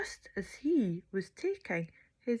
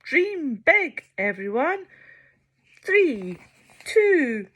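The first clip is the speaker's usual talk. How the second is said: loud, steady countdown; 7 semitones higher, 10 dB louder, slower than usual. Acted. Sheila counting down to launch, loud. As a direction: count down with big energy.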